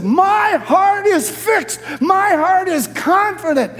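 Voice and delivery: high, slow preaching shout; emphatic proclamation. Acted. Preacher voices David repeating his resolve, emphatic proclamation. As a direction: proclaim it with total conviction, drawn out. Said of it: "to make it even more emphatic".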